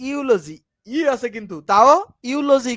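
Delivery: ইউলজি said slowly, starting with a clear yu sound; slow, careful ইউলজি with a yu onset; drawn-out pronunciation. Shown as a demonstration. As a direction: stretch ইউলজি out for the class. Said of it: "খেয়াল করো".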